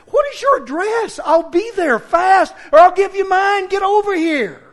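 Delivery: loud, high challenge, slowed on I'll be there fast; daring the caller to come fight; angry challenge. Retold or imitated. Pastor retells challenging a screaming caller, loud and high. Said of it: "I finally said".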